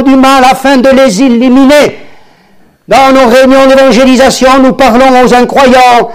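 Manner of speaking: high, loud fervent preaching; preaching at full force. Acted. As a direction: lift the congregation, build to Alléluia.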